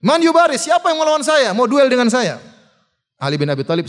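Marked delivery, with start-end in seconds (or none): 0.0-2.4 loud, high call to a duel; shouted challenge. Retold or imitated. Amr dares anyone to duel him, shouting. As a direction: shout it out as a warrior's dare. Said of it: "Amr bin Wud teriak lagi".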